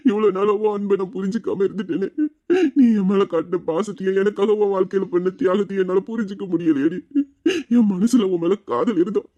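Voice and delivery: ordinary delivery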